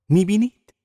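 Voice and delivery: loud, high-pitched "you see?"; triumphant. A narrator's reading. Catherine proved right by Myrtle's answer, triumphant. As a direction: crow it as a point proved. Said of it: "پیروزمندانه گفت".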